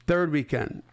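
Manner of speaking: ordinary talk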